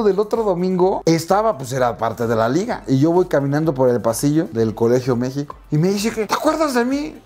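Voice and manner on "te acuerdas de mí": much higher taunting referee voice; angry referee taunting player, high mock voice. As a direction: sneer it up high like a bully. Referee confronting him.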